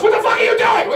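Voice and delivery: high, shocked wife's outburst; a hammed-up startled wife.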